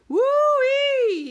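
very high, very loud whoop; gleeful shout. A narrator's reading. Dwayne thrilled by the ride, a high loud gleeful whoop. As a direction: let out a joyful whoop. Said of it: "he shouted with glee".

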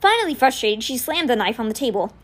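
usual speaking voice

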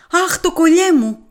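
high, loud joyful exclamation; overjoyed wife crying out as her necklace returns. A narrator's reading. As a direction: gasp with delight at the found necklace. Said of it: "επιφώνημα ευτυχίας".

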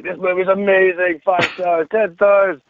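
high-pitched, overblown rave; mock gushing. Friends posting fake IMDB reviews, mock gushing.

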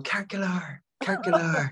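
high, hammed-up Irish accent; playful mimicry of children doing an Irish accent; wee Irish accent. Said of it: "with a wee Irish accent".

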